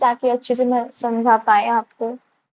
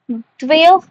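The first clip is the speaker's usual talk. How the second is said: ordinary talk, nothing marked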